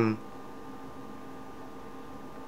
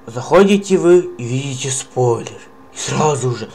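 ordinary talk

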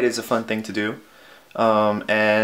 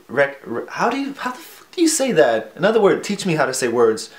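normal talking voice

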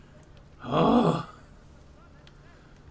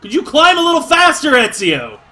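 loud, high exasperated demand; high-pitched impatient hollering; impatient yell; 8 semitones higher, 7 dB louder than usual. Real, not acted. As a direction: yell at the character to hurry up. Yelling at slow game character.

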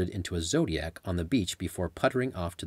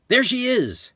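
high, loud excited call; excited shout; 7 dB louder, slower than usual. Acted. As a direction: point her out with relief. Spotting Janine on the trail.

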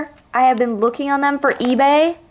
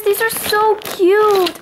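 gushing slow; 7 semitones higher, much slower than usual. Real, not acted. Gushing over extras.

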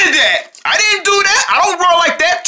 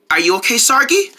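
ordinary talk

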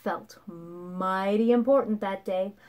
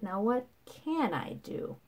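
slow, soft thinking aloud; pondering; 10 dB quieter than usual. A narrator's reading. Rabbit challenged to prove his boast, pondering softly. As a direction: wonder it quietly to yourself. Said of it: "thought the rabbit".

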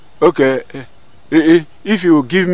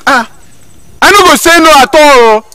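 much higher and louder, bursting with eagerness; eager promise; 10 semitones higher, 9 dB louder than usual. Acted. Hunter eagerly promising to accept any condition, high and excited. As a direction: promise it all at once, overeager.

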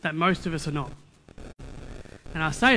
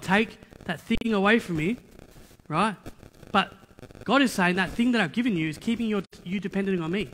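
normal talking voice

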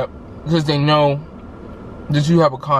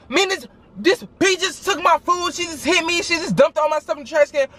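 upset complaint; 10 semitones higher than usual. Retold or imitated. Complaining to teacher about bully.

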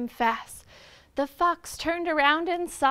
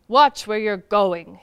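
low, loud bear voice; deep threatening bear; growled; 6 semitones lower, 9 dB louder than usual. A narrator's reading. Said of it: "he growled".

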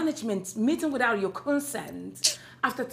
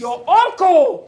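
stern shout; 2 semitones higher, 14 dB louder, slower than usual. Acted. Mother scolding daughter.

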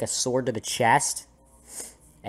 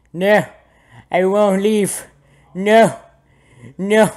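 high, loud bursts of refusal; mock defiant cry; 7 semitones higher, 9 dB louder, slower than usual. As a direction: refuse loudly in playful protest. Playfully resisting pull in game.

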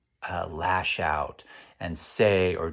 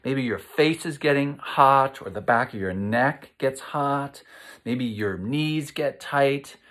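ordinary delivery

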